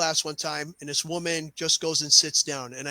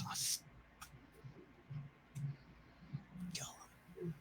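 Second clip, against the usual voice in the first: quiet fury; 24 dB quieter than usual. Retold or imitated. Mother's fury in retold story.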